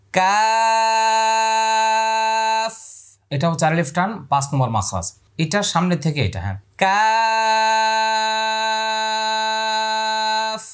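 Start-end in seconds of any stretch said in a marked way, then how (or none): 0.1-2.7 কাফ held very long on one high note; slow tajweed demo of কাফ; letter name drawn out. Shown as a demonstration. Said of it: "এবার এটা বলছি". 6.8-10.6 কাফ held very long on one high note; slow tajweed demo of কাফ; letter name drawn out. Shown as a demonstration. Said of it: "শেষে ফা আছে".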